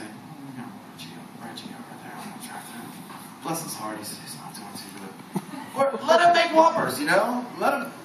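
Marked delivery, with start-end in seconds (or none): 0.0-5.1 much softer, low, half-whispered gossip; secretive mock-sympathetic hush; hushed confiding aside. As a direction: lean in and murmur it behind his back. Manager whispering about Reggie.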